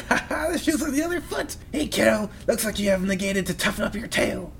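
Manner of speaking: put-on high, soft cackling old-witch voice; gloating Baba. Fortune teller taunting the kid, high gloating voice. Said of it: "Baba's pretty excited".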